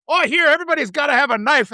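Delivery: put-on high, approving Wisconsin-accented cop; northern Wisconsin cop. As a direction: imitate a folksy, pleased Wisconsin cop. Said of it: "by a cop in northern Wisconsin".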